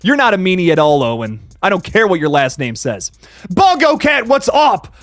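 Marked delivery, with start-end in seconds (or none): 3.5-4.9 shouting a cheerful hello to a chat regular; excited greeting. Real, not acted. Greeting a viewer by calling out.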